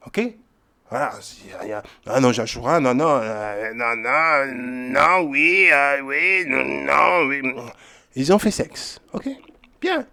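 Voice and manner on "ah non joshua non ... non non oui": drawn-out wavering no and yes; hammed-up moaning objection, words stretched; mock protest. Listeners object to Joshua's sex reading, mock wailing protest.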